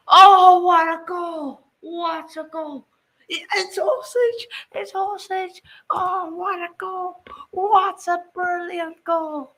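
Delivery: high, ecstatic goal scream; long goal yell. Real, not acted. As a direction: yell the goal in pure joy. Stunning goal scored on stream.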